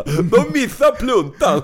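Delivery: loud, high, drawn-out announcement; happy, proud shout. Retold or imitated. Drunk friend bragging about the missed hip flask, proud slow shout. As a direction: crow triumphantly, far too loud. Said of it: "vilket han lyckligt och stolt".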